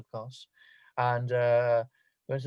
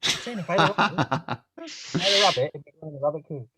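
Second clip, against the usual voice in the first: high, loud and laughing; laughing insistence; 3 semitones higher, 4 dB louder than usual. Real, not acted. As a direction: insist while cracking up.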